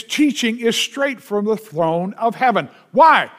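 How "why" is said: loud why. Acted. Rhetorical question.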